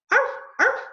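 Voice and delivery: very high, loud little yaps; puppy answering, high yapping; puppy barking. Acted. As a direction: bark like a tiny puppy. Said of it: "he answered".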